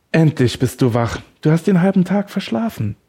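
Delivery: playful grin in the voice, lifting high; grinning. A narrator's reading. Kidnapper greeting his waking captive, grinning. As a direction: say it through a grin, pitch climbing. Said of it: "grinste er Raphael an".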